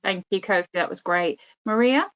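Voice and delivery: ordinary talk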